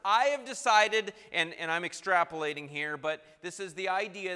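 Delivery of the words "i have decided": loud, raised-pitch proclamation; acting out a proud man laying down the law; imperious decree. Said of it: "this is the attitude".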